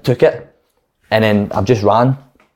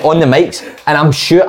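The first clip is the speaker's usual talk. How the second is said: normal talking voice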